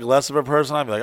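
ordinary talk, nothing marked

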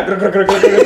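rapid rolled "grr" noises with laughter; imitated grinding keys. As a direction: mimic keys grinding inside a head. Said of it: "κογόντουσαν μέσα κλειδιά". (μίμηση ήχου από κλειδιά που τρίζουν)

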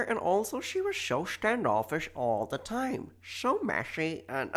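exaggerated Belfast lilt that drifts sky-high; Northern Irish accent. Second try at reading the message in a Northern Irish accent. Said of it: "I'm not doing the accent".

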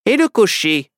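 high, loud sheriff firing back; sheriff's retort. A narrator's reading. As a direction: toss the question back as the sheriff. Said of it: "lui rétorqua le shérif".

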